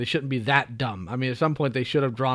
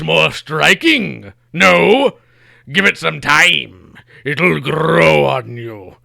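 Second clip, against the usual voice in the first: loud, slow, drawn-out villain speech; Mumm-Ra; 9 dB louder than usual. Acted. Villain gloats to the hero about his remade planet, grandiose villain voice. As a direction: relish every word like a theatrical Bond villain. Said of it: "follow that up with my own panel".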